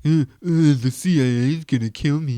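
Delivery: wife mocking her husband as a babbling caveman; mocking caveman guy voice. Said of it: "she was like".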